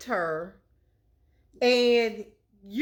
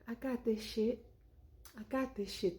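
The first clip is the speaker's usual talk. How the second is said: quiet menace; 2 semitones lower, 12 dB quieter than usual. Retold or imitated. Absalom reassuring Tamar.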